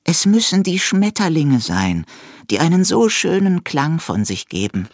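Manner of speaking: hohe, verträumte Eidechsenstimme; verzückt vom Klang schwärmen; schwärmende Eidechse. A narrator's reading. (die Eidechse schwärmt dem Hasen vor, hoch und verträumt)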